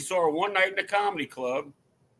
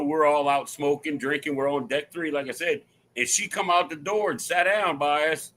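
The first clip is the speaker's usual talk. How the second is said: ordinary delivery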